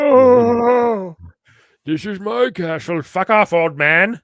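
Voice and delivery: high, loud hammed-up castle owner; mock castle lord. Voicing the cover character, a loud hammy king. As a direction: shout like a grumpy game king.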